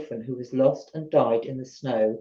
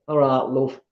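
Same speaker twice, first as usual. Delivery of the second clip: Yorkshire greeting; 2 semitones lower than usual. Pretend. Greeting the chat before the West Yorkshire reading, in a light Yorkshire accent. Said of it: "I don't know if that's Yorkshire or not".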